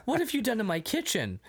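high scolding mother voice; mock outraged mom. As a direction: play an appalled mother. Mom finds wrecked kitchen.

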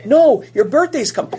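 mother refusing. Retold or imitated. Mother voiced refusing the child.

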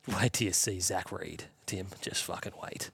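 whispered, hushed teasing threat; mock whisper. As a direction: whisper it like a gleeful rival fan. Mocking rival fans' hype.

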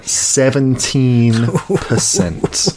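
very slow, stretched-out score announcement; drawn-out reveal. Pretend. Host revealing the film's score to co-host, drawn-out reveal. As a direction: draw out each word for the reveal.

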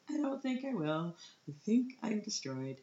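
high mock-tearful whimper; sobbing, retold. Wife to reassuring husband, mock sobbing. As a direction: reenact a wailed protest in a high, pinched voice. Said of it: "sob up the steps".

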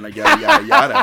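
high, loud sarcastic yada yada; mocking singsong. A streamer mocking a troll's sponsorship excuses, in a loud, high singsong.

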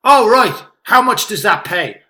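passive aggressive. Retold or imitated. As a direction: imitate a comedian's pointed, belittling question. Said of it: "vaguely passive aggressive comedian type stuff".

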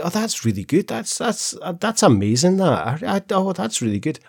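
high, breathy delighted gushing; gushing shop assistant. Retold or imitated. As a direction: rave like an impressed shop lady. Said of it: "She's like".

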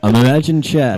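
booming, mic-close voice. Real, not acted. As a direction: boom it right into the microphone. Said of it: "Booming voice".

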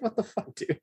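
high-pitched incredulous retort; mock disbelief. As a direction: react in high, laughing disbelief. Quotes own reaction to racist typecasting.

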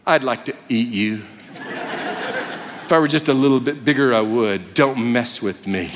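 low, laughing voice of a cat voiced as a mock threat; menacing cat. Pastor voicing a cat eyeing its owner, low and menacing through a laugh. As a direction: growl it like a lightly played cat plotting to eat you. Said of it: "The cat is sitting there going".

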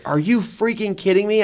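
high-pitched mock outrage; incredulous. Friend reacting to being outraced on the track, mock incredulous. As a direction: retell his disbelief, pitched well up.

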